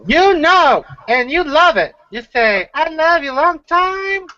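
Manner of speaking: squeaky, very high teasing voice; high mock voice. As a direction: jump way up high, mock-flirty. Teasing with a put-on voice.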